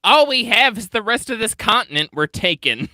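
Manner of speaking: loud, high-pitched proclamation; hammed-up shout of conquest; mock outburst. Joking about taking the rest of the continent, mock shouting.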